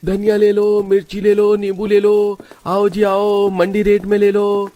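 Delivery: high, chanted vegetable seller's cry; street hawker's call. Acted. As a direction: call each item out on a held high note. Vegetable seller calling out wares.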